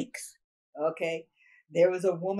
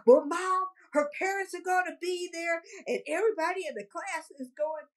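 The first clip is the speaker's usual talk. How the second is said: put-on whiny kid; 9 semitones higher than usual. Kid begging mom.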